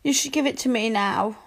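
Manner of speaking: usual speaking voice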